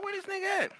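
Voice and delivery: high, soft puzzled question. Retold or imitated. Waiting for the blunt, asking high and puzzled. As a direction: ask it high and hushed, baffled. Wondering where the pipe went.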